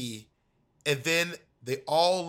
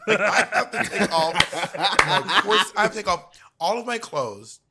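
laughing exasperation; 6 semitones higher, 8 dB louder than usual. Real, not acted.

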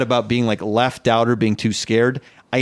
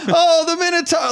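much higher, gushing group voice; high excited tourists. Retold or imitated. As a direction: gasp at the Minotaur, thrilled. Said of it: "I'm hearing".

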